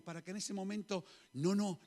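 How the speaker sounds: normal talking voice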